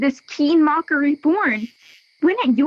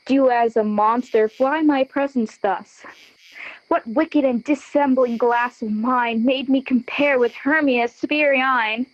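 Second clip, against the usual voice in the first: normal talking voice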